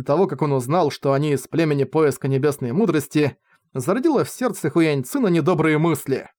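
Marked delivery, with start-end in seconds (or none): none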